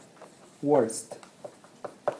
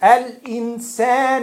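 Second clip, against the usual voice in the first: Quran recitation; 6 semitones higher, 3 dB louder than usual. Shown as a demonstration. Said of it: "one word at a time".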